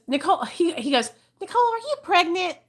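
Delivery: high, fast, curious mock question; imitating dad. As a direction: play the father probing gently. Retold father-in-law asking.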